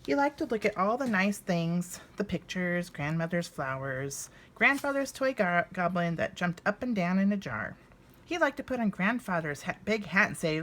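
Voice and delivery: lively storybook narration, voicing each bear; reading to children. A narrator's reading. Reading Little Bear's Visit to viewers, warm storybook narration. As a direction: read it aloud like a picture book at bedtime. Reads a children's book aloud.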